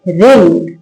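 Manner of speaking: रिंग begun straight on R, falling tone; silent W. Shown as a demonstration. Teacher reading W-R-I-N-G aloud, रिंग with silent W. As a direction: model रिंग with no W sound. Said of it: "कैसे पढ़ेंगे".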